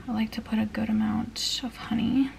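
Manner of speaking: soft unvoiced whisper. Real, not acted. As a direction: murmur it to yourself. Aside while making the bowl.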